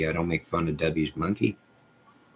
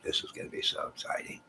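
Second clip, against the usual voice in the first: quick excited whisper; whispered quote; 10 dB quieter, faster than usual. Retold or imitated. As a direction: whisper it fast, thrilled. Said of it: "I'm like".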